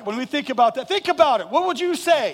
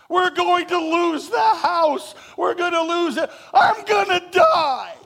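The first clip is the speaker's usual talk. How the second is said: higher and louder, building to a mock shout; dramatic panic; 8 semitones higher, 5 dB louder than usual. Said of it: "some of us that go".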